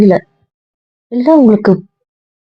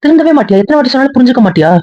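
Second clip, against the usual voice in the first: angry scolding; 6 semitones higher than usual. Acted. Scolding over the video call.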